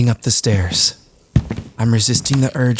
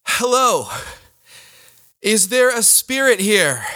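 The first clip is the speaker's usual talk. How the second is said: nervous calling out; 10 semitones higher than usual. Acted. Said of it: "I'm nervous".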